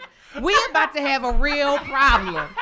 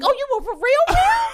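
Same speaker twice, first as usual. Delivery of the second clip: shocked disbelief; 10 semitones higher than usual. Retold or imitated. Realizing the date meant real blood, shocked cry. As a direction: voice climbing to a high cry on girl. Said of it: "I'm like".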